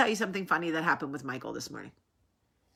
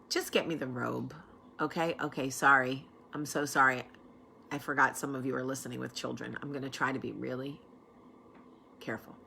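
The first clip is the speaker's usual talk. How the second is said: quiet aside; 6 semitones lower, 7 dB quieter than usual. Retold or imitated. Quiet aside, apologizing for swearing.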